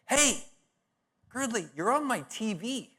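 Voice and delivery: put-on high, eager call of an old schoolmate; excited old classmate. Old schoolmate calling him, high and eager. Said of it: "are calling me".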